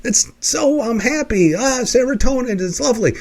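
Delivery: beaming mock delight; happy gushing. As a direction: swoon over the lovely weather. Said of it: "emotional reaction like".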